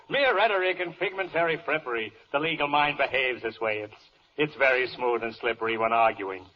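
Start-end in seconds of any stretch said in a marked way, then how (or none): none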